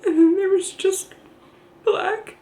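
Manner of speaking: real crying, voice pitched high. Real, not acted. Mother telling what the ultrasound showed, crying. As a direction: push the words out through tears. Tearful account of losing baby.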